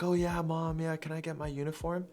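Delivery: mock kid voice, held high; excited kid. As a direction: pitch it up like a kid asking mom. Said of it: "the kid's gonna be like".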